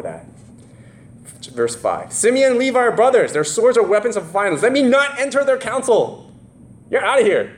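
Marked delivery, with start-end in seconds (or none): 2.1-6.3 stern Jacob. Acted. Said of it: "That was Jacob's blessing". 6.9-7.5 dismissive father. Retold or imitated. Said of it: "That's what Jacob said".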